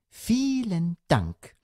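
high, slow, sugary sing-song; fluting. A narrator's reading. Mother thanking father for his insult, fluting. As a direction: flute it with false sweetness. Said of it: "flötete die Mutter".

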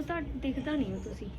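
ordinary talk, nothing marked

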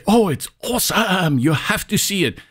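high-pitched, overblown enthusiasm; mock hype. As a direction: gush like someone overpromising. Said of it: "when people make huge promises".

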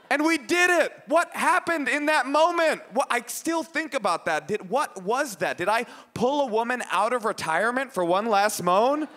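high-pitched disbelief, slowing for emphasis; incredulous amazement. Acted. Comedian recalling that moment, high incredulous astonishment. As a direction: marvel at it as if still baffled. Marvels at the absurd outcome.

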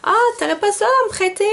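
high, quick voicing of her mother's ask; high-pitched quick mother imitation; imitating mom. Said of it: "ma mère, elle m'a dit".

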